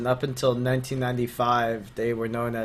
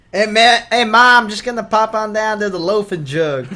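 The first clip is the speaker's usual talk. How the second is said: put-on southern accent; 10 semitones higher, 11 dB louder than usual. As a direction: yell it like a country boy to Mom. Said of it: "Why does he have a southern accent?".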